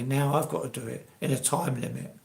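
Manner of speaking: ordinary talk, nothing marked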